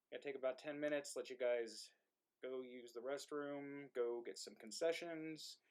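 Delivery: usual speaking voice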